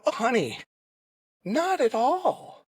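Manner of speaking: setting her up with playful fake serenity; sarcastic and facetious. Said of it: "sort of being sarcastic and facetious".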